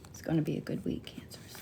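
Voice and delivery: breathy whispered sign-off. Real, not acted. Whispering a good-week wish to Cancers. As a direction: whisper it like a secret blessing. Tarot reading ends softly to viewers.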